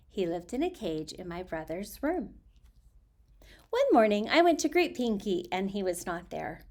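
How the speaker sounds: expressive storybook reading voice; reading aloud. Acted. Reading a children's author's letter to her audience, expressive storybook voice. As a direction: read the letter to listeners, lively.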